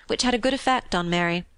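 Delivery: ordinary delivery, nothing marked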